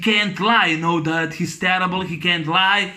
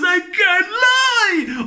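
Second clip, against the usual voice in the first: high, long-drawn comic wail; mock anguished wail; 11 semitones higher, much slower than usual. Retelling Fletcher breaking down in court, mock anguished wail. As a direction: wail it slowly, stretching the last word. Re-enacts Fletcher's helpless outcry.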